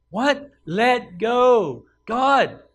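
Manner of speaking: high, loud incredulous yelling; shouted questions from the cliff edge; panicked disbelief. Acted. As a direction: yell back in shocked protest. Acted panic of man on cliff.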